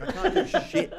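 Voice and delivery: half-laughing at his own plight. Real, not acted. Winger to podcast mates, laughing about being helpless on the wing.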